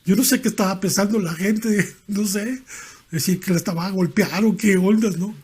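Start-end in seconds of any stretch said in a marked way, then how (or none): none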